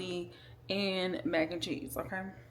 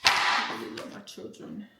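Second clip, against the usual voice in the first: tearful breathy whisper; barely getting the words out through tears; crying softly; much slower than usual. Real, not acted. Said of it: "I get so emotional".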